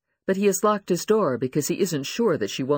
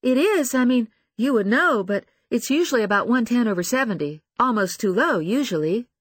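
higher, louder, flustered voice; flustered young woman; 9 semitones higher, 5 dB louder than usual. Acted. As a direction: rattle it off flustered.

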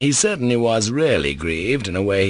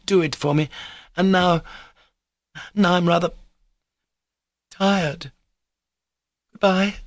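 higher, raised voice of a tired woman; weary pleading woman; 7 semitones higher than usual. Acted. Woman asking Alexei for a favour, weary and pleading. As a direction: plead in a higher voice, tiring into pauses. Figure in story narration.

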